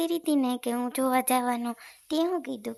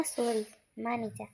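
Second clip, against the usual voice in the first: slow, much softer apology; soft plea; 3 semitones lower, 7 dB quieter than usual. Acted. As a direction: coax her gently. Boyfriend pleads softly.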